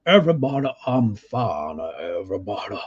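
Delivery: imitating a cartoonish Southern accent, dropping low at the end; lightly mock a Southern senator's reassurance in a Southern accent. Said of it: "he's got the full Southern accent".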